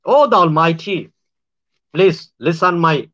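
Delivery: usual speaking voice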